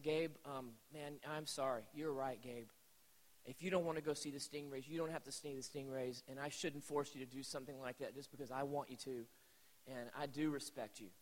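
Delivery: low, soft, choked-up dad; retold humbled father apologising gently; hushed and sorry, a touch of tears; tearful apology. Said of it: "tearing up and got a lump in my throat".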